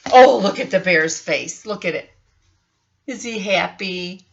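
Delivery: ordinary delivery, nothing marked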